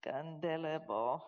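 slow, murmured tongues syllables; fervent private prayer in tongues; praying in tongues. Shown as a demonstration. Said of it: "I ain't gonna pray in tongues".

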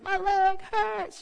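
put-on slow, high sleepy child whine; half-asleep child whimper. Daughter half asleep complaining to mom, slow high whine. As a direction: drawl it like a drowsy kid in pain. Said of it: "She's like".

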